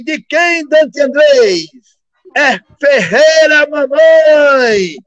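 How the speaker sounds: radio announcer bellowing the new nickname; announcer goal cry. Retold or imitated. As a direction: stretch out the name like a big goal call. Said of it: "Aí o locutor".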